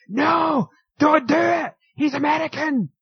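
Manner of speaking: high, loud mock yell of a panicked wrestler; Chris Jericho yelling. Retelling Jericho shouting at Cesaro, high mock yell. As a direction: shriek it like a wrestler frantically calling his partner off. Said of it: "Chris Jericho going".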